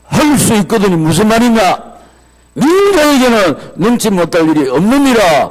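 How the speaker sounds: high, loud scolding; stern rebuke. Acted. Jesus reproving the doubting father, loud and stern. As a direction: rebuke the doubter with full force. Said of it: "예수님이 책망합니다".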